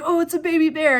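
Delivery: high, loud thrilled cry; excited gasp. Retold or imitated. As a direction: squeal at a baby bear. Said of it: "I was super excited".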